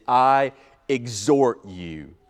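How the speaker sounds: slow, weighty reading of "I exhort you"; solemn exhortation. Retold or imitated. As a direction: lay each word down slowly. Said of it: "literally translates it".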